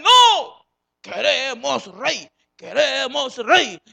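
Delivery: demanding shout. Acted. Voices the people demanding a king.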